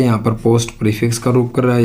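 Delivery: usual speaking voice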